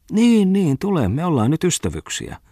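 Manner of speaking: high singsong wheedling; friendly coaxing. A narrator's reading. Bungman asks the editor to be friends, coaxing. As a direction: coax him along in a high, lilting voice.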